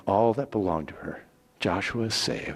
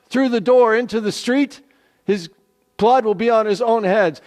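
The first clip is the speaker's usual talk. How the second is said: spies swearing an oath, higher and louder; solemn, insistent promise pitched up; earnest spies; 10 semitones higher, 6 dB louder than usual. Acted. The two spies binding Rahab to the oath, raised and earnest.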